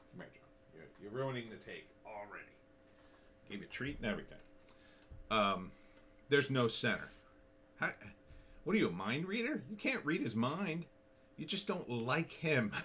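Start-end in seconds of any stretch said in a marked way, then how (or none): 0.1-4.4 soft, muttered mild reproach; off-mic aside. Real, not acted. Host to a pet spoiling the recording, quiet aside. Scolds someone off-take mid recording. 7.8-12.7 incredulous challenge. Real, not acted. Mocks De Niro's claim to know.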